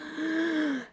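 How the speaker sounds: breathy gasp. Acted. Magpie sees the shining buttons.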